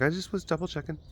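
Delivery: high, soft mock voice of his wife; imitating wife. She's like.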